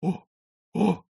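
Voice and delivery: short, high gasps of astonishment; astonished, high little gasps; wheezed amazement. A narrator's reading. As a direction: choke out two stunned ohs. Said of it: "захрипел в изумлении Демидов".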